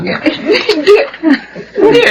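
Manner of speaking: high laughing denial; laughing through tears. Acted. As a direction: deny crying while giggling. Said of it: "umiiyak ka na naman?". (tumatawang tumatanggi habang umiiyak)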